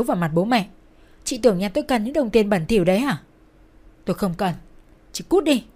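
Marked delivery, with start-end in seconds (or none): 5.1-5.6 raised, louder-edged angry order; angry dismissal. A narrator's reading. Brother sending his disgraced sister away, angry. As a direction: order her out in anger.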